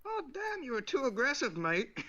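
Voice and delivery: high, put-on Aussie; high-pitched comic Australian; Australian accent. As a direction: chide in a mock Australian accent. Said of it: "then you went Australian".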